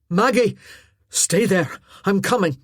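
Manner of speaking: loud call across the courtyard; shouting. Acted. Callum shouting up to Maggie on the scaffold, loud call. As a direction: yell it out to her from below. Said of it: "he shouted".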